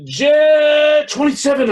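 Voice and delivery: loud, high frantic shout; shouted bid. Acted. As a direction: blurt the bid out. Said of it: "I shouted".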